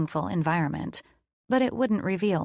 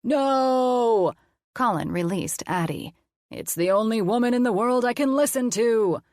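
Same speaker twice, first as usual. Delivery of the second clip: louder, hammed-up villain cry; mad scientist; 4 semitones higher, 4 dB louder than usual. Colin playing mad scientist to the kids, defeated. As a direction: wail it like a defeated cartoon villain.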